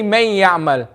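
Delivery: MAY YA'MAL unstretched, no nasal hum; wrong, without gunna. Shown as a demonstration. Teacher warns class what not to say, without gunna. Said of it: "we're not going to say".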